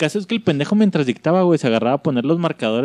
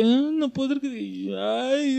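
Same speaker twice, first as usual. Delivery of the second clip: very high put-on whimper; a hammed-up teary whimper; mock crying teacher; 12 semitones higher, 4 dB quieter, slower than usual. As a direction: imitate the teacher breaking into tears.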